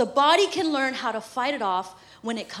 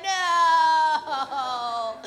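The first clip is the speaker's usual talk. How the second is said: tearful no. Retold or imitated. Mom protesting to the nurse, tearful no. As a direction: protest through tears. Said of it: "And I'm like".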